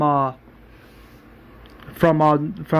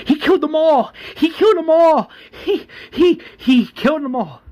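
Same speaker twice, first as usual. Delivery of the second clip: high shrill scream, much higher and louder; panicked screaming; 13 semitones higher, 3 dB louder than usual. Retold or imitated. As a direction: shriek it in terror. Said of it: "one of these residents are screaming".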